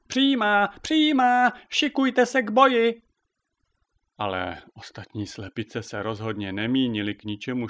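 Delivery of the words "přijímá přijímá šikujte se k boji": very high, loud hen yell; screeching battle cry. A narrator's reading. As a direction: shriek orders to rally the troops. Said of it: "ječela na ostatní slepice".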